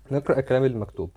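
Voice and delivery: normal talking voice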